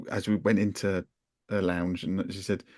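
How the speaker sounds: usual speaking voice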